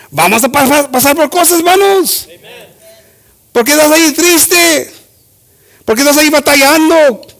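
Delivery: high-pitched, forceful pulpit exhortation; impassioned bilingual preacher; preaching. Acted. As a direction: drive it home to the congregation.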